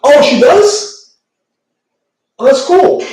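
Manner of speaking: light up at the news; pleased surprise. Real, not acted. Surprised by a chat message.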